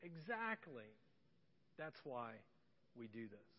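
soft, slow exactly; tender agreement. Retold or imitated. Dad affirming his daughter, tenderly. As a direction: gently affirm a child. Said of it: "I was like".

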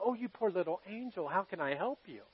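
soft sugary mock-tender voice; mock sweet coo. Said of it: "like little angels and go".

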